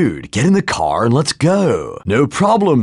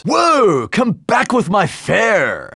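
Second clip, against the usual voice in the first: startled cry; 9 semitones higher, slower than usual. Acted. Money lost in game.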